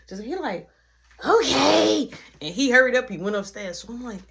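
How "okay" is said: put-on high, loud kid voice insisting; little brother voice. Little brother announcing he's ready for food, high kid voice. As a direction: whine like an eager little kid.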